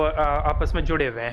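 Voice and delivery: usual speaking voice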